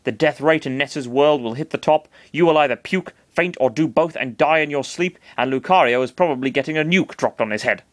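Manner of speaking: ordinary delivery, nothing marked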